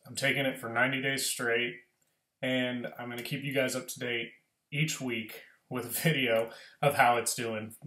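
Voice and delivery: ordinary talk, nothing marked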